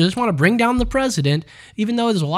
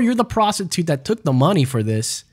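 ordinary talk

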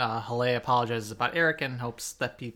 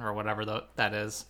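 ordinary delivery

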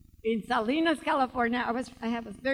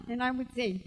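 ordinary talk, nothing marked

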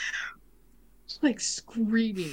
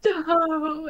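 high, mock-sobbing no, no; hammed-up sobbing imitation; imitated crying; 12 semitones higher than usual. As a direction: copy a crying guy from a video. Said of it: "and, like, crying".

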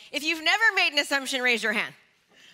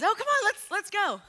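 higher, playful hurry-up call; mock impatience; 8 semitones higher than usual. Shown as a demonstration. Speaker showing what her hand signal means, playful hurry-up. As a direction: urge the slides along, half-joking. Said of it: "it means".

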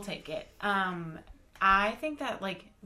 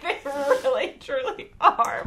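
laughing; 6 semitones higher, 12 dB louder than usual. Real, not acted. Laughing at friend gagging.

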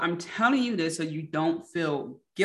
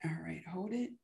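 guided deep breathing; 5 dB quieter than usual. Real, not acted. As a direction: lead the breaths gently and quietly. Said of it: "let's take a deep breath".

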